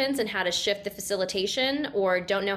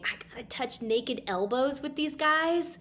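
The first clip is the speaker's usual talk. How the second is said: a contained, imitated scream of alarm; retold inner scream; 6 semitones higher than usual. Newcomer silently panicking between two guys, mock inner scream. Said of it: "in my mind, I'm screaming".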